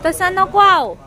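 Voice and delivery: loud, high-pitched call to husband; calling out objection. Real, not acted. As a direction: call out your objection across the shop. Calls out objection to husband in shop.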